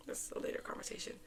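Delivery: very soft, whispered tease; hushed aside. Real, not acted. Podcaster putting off a story for later, whispered aside. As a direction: drop almost to a whisper.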